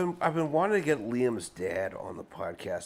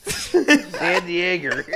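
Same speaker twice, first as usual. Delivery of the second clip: put-on slow, loud Boston accent; 9 semitones higher, 9 dB louder, much slower than usual. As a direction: draw San Diego out in a Boston accent. Boston accent city name.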